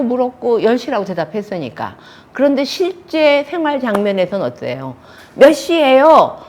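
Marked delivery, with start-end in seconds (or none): 5.3-6.4 loud, high, slow angry question. Shown as a demonstration. Real-life quarrel over coming home late, angry. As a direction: demand the time as an accusation. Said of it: "화나서 얘기했고".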